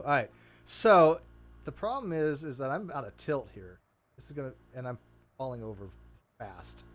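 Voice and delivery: normal talking voice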